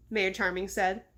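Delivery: ordinary delivery, nothing marked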